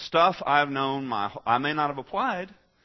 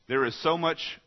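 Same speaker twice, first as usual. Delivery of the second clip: ordinary talk, nothing marked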